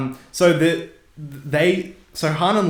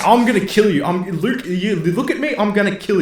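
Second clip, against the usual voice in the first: higher, louder hammed-up threat; mock furious threat; 8 semitones higher, 4 dB louder than usual. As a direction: play Han spitting a threat while being choked. Han threatens Luke while choked.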